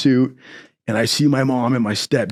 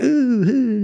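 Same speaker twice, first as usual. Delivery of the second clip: delighted ooh; 12 semitones higher than usual. Retold or imitated. Seeing snow for first time.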